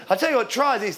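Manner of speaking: usual speaking voice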